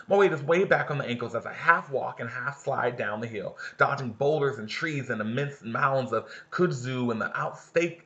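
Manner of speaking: lively read-aloud for the class; story reading. A narrator's reading. Teacher reading the novel aloud to her class, lively narration.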